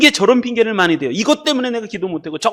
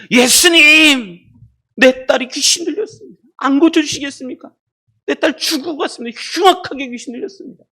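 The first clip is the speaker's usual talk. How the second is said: desperate cry; 7 semitones higher than usual. Acted. Preacher as parent begging Jesus for the daughter, desperate cry. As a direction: cry out the name and keep begging up high. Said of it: "그러겠어요?".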